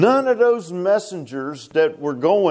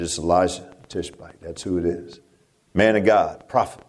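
king's realization; 9 semitones lower than usual. Retold or imitated. Ahaziah naming the prophet to his messengers, low and hushed. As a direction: drop low as the king realizes. King Ahaziah quoted recognizing Elijah.

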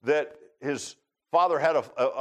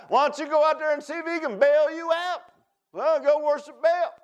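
high-pitched mocking taunt; smart aleck; 11 semitones higher, 3 dB louder than usual. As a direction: play a sarcastic God sending them off to a rival. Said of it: "I can be smart aleck sometimes".

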